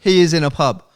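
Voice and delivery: high, loud, final verdict; emphatic insistence. Retold or imitated. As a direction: drive it home loudly, utterly sure. Retelling his insistence to Andy.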